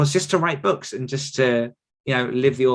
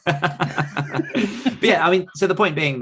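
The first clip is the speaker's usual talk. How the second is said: laughing; 8 semitones higher, much slower than usual. Real, not acted. Guest laughs after the host's joke.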